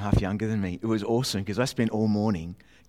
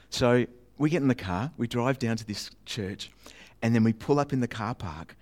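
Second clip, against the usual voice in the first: usual speaking voice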